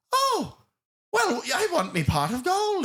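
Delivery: high, hammed-up leprechaun Irish brogue; imitating a wobbly Irish accent; mock Irish accent. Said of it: "his accent is all over the place".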